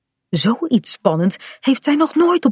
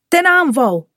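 higher battle cry; calling out; 7 semitones higher than usual. A narrator's reading. Kaat sprints after Bink, calling out a battle cry. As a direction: sound the charge, pitched up. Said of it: "roept ze".

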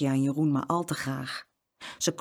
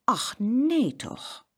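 higher, very slow, stretched-out fussing; repeated exclamation; 6 semitones higher, much slower than usual. A narrator's reading. Farmer's wife fussing over the twins, drawn-out exclamation. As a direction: draw it out as a habitual exclamation. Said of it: "zei steeds".

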